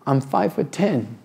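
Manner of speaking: usual speaking voice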